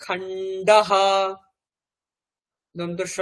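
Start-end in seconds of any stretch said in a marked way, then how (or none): 0.0-1.1 খান্ডাহা stretched slowly, visarga sounded as a full ha; slow Sanskrit word. Shown as a demonstration. Teacher sounding the visarga for students, slow and drawn out. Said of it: "সুধা".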